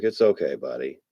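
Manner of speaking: soft, hushed comfort to a frightened pet; calm the scared cat gently; soothing the cat. Retold or imitated. Owner comforting his frightened cat at night, soft and soothing.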